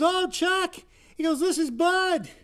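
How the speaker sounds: dead buddy calls his friend from heaven, voice pitched high; ghostly high friend on phone. Acted. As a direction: greet him high and bright like a ghost on the line.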